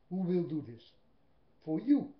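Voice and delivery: for you? thrown out loud and rising; loud pleading question. Real, not acted. Preacher asking followers who will suffer, loud and pleading. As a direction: hit the last words hard, pleading.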